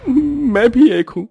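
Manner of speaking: high, strained voice of a desperate father; distressed father. Acted. Father telling his wife he lost his job, high and anguished. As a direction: pour out a jobless father's despair, voice climbing.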